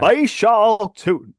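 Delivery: Baishal Tootin drawled slow and high; Southern twang. Shown as a demonstration. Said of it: "you have to say it with that Southern twang".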